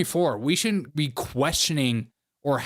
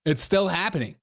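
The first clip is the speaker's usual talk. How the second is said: ordinary talk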